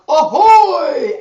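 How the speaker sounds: loud sailor's call; hearty shouted ahoy. A narrator's reading. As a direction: call it out across the dock.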